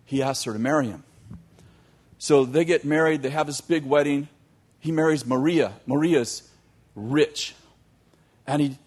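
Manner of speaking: ordinary talk, nothing marked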